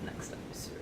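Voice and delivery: hushed whisper. Acted. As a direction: breathe it as a whisper. Teasing the next episode.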